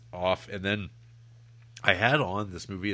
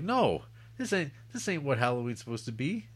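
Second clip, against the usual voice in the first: higher-pitched, whiny objection; mock protest; 6 semitones higher than usual. Co-host recalling switching off a boring film, mock protest. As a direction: plead in complaint, drawing out the no. Acting out own rejection of film.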